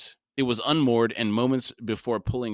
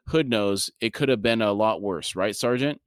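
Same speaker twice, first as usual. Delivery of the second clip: ordinary delivery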